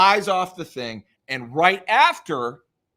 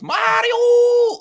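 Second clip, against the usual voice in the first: much higher, loud drawn-out shout; Luigi's scream; 8 dB louder than usual. Retold or imitated. Imitating Luigi screaming for Mario, loud and high. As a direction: scream for your brother in fright. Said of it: "where he goes".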